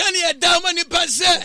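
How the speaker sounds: loud, high, fervent shouted echo of the verse; preaching at full force. Real, not acted. Radio preacher shouting the verse back to the listeners, at full force.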